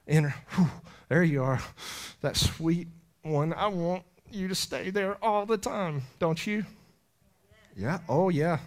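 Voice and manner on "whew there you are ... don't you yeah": usual speaking voice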